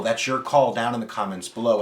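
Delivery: ordinary talk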